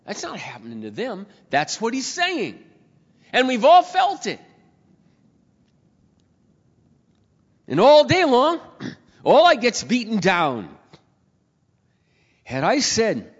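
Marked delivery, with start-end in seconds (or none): 3.3-10.7 loud, high, slowing preacher's cry; preacher telling the congregation we've all felt it, high and loud; full-force preaching. Acted. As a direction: lean into the weight of beaten down. Preaching climax.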